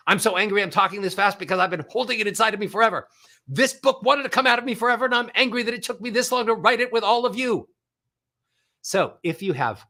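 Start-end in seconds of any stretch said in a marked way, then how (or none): 0.1-7.7 rapid high one-note angry rant; fast monotone anger. Shown as a demonstration. Coach demonstrating how to sound angry, rapid monotone rant. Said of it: "have to speed up the pace".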